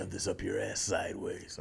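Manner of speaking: low, gruff tough-guy threat; Wolverine. Acted. Imitating a comic hero insulting someone, low and gruff. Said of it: "He has a gruff voice".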